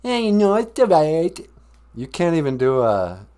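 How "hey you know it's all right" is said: high, loud fake Italian; bad Italian accent. Columbus answering the host's greeting, badly faked Italian accent. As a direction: shrug it off in mock Italian. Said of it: "Italian accent at all".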